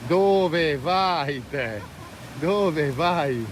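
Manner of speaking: very slow, drawn-out call, vowels stretched long; soft, lingering sing-song call to an animal; richiamo cantilenato. Real, not acted. Chiama una mucca di passaggio. (richiamo lento e cantilenato)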